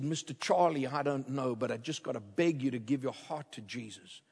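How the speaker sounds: low, soft, halting appeal; hushed, humble begging in retelling; broken, shaky plea. Retold or imitated. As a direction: plead quietly, as if close to breaking. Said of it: "in a broken, shaky voice".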